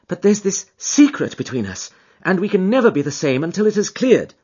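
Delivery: earnest husband. Acted. Husband pleading with Holmes about his wife, earnest. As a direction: insist on his wife's love.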